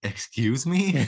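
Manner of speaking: indignant echo. Retold or imitated. Richard echoing the voice, drawn out and affronted. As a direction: drag it out, mock offended. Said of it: "And Richard's like".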